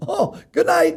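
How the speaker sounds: high, rueful "oh, good night"; mock dismayed. Realising he wrecked the mobster's wall, high and rueful. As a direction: sigh it out high, defeated. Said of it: "I go".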